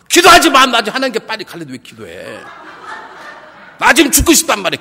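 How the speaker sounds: high, shouted mock anger; angry outburst. Dying sister refuses church members' prayers, acted angry shout. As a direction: snap loudly at those praying for you. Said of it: "기도 막 화를 내는 거예요".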